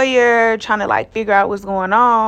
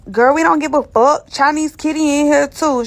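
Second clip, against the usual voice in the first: higher-pitched mock officer, unimpressed; dismissive officer imitation; 5 semitones higher than usual. Imitates other officer dismissing her fame.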